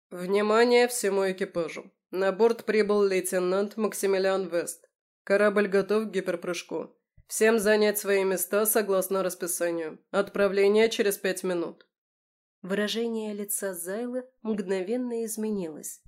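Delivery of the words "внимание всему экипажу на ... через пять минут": louder commanding intercom voice; loudspeaker announcement. A narrator's reading. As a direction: announce it over the ship's speakers. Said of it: "приятный, но властный мужской голос из динамика громкой связи".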